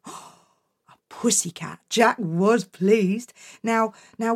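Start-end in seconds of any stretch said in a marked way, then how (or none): 0.1-1.8 slow whispered gasp; awed whisper. Acted. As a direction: gasp in wonder. Jack amazed.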